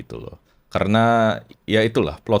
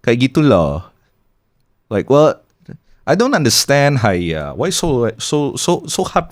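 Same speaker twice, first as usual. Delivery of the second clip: mock complaint about Jaksel slang in a Singlish accent; Singlish roast voice; 6 semitones higher than usual. Podcaster roasting Jaksel speakers in a Singlish accent. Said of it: "kayak gaya-gaya Uncle Roger".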